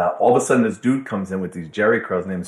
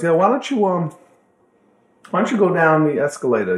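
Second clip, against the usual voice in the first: sly Pookie; 7 semitones higher, 4 dB louder than usual. Retold or imitated. Pookie voiced slyly.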